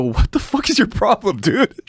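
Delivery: high-pitched mock outrage; indignant squawk at the alien; exasperated retort. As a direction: protest it high, as if retelling an argument. Acting out his argument with alien.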